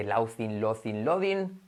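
"laughing" as "lau-thin", "lo-thin", "lo-din"; common mispronunciations. Shown as a demonstration. Teacher mimicking many learners, common mispronunciations. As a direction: slowly garble "laughing" with th and d. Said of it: "dicho por mucha gente".

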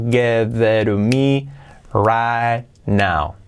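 stretched-out "give it to me"; very slow. Shown as a demonstration. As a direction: spell it out slowly for practice. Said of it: "Practicar esto".

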